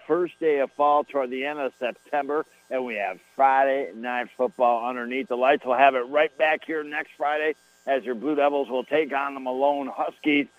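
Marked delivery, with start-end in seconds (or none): none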